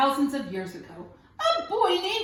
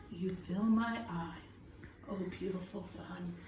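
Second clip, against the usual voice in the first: much softer and lower, reverent; hushed adoring prayer; 8 semitones lower, 12 dB quieter than usual. A narrator's reading. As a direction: let the praise fall to a hush. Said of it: "he prayed to the sun".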